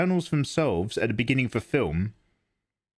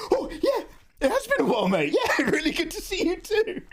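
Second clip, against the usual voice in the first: high, excited squeaky reply; hyped up. Retold or imitated. Streamer acting his excited reply to Mel, high squeaky. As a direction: gush back high and thrilled. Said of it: "I was just like".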